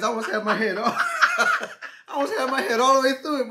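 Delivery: loud, high, laughing shout; cracking up. Real, not acted. Man retelling his face in massage hole, cracking up. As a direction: shout it through real laughter.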